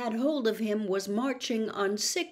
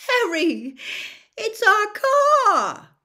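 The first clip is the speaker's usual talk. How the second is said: louder, higher, slow cracking cry; voice breaking with relief; 12 semitones higher, 9 dB louder, slower than usual. A narrator's reading. Ron finding his lost car, voice breaking. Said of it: "his voice breaking with relief".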